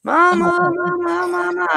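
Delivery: high, yelping dog cries of mama; dog calling mama in a high wail; dog screaming mama. Retold or imitated. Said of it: "just screaming".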